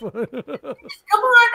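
laughing mock taunt. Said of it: "And I was like".

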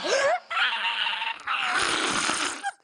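very high gasping shriek of fright; shrill terrified gasp. Real, not acted. Gamer jumpscared by the scary staircase, shrieking gasp.

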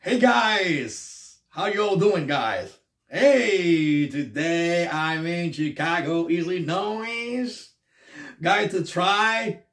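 big excited YouTube food host intro; Scott Eats voice. Retold or imitated. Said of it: "That's Scott's Eats".

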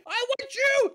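high loud grabby call; shouted want. Acted. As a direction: yell it at the fleeing fish. Calling after fleeing fish.

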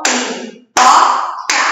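Pipoca slowly chopped into pi, po, ca; three separate syllables. Shown as a demonstration. Teacher showing three syllables, Pipoca slow and split. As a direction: beat out Pipoca with pauses between syllables. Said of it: "Três sílabas".

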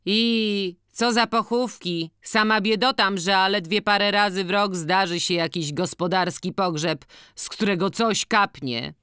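high village matron's lament; organist's wife, complaining. Acted. (wysoki, utyskujący głos organiściny)

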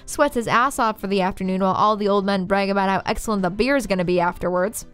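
ordinary delivery

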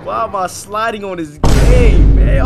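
high, loud disbelief, dragged out slowly; exasperated yell. Real, not acted. Losing at the game, yelling in disbelief at his own play. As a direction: cry out why you're losing so badly.

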